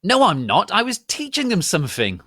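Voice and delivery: high loud hammy denial. A narrator's reading. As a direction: deny it like a stage villain. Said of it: "Snape clammed".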